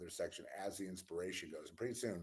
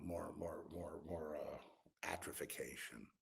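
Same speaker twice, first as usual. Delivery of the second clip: whispered; 6 dB quieter than usual. Real, not acted. Whispered trailing list.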